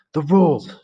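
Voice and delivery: high, loud cry; shout. Acted. Ralph shouting at Jack about the rules, high loud cry. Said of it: "shouted Ralph".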